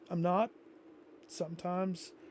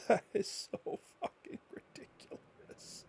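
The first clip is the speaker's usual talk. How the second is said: defeated whisper; 2 semitones higher, 10 dB quieter than usual. Real, not acted. Sighing, whispered at game.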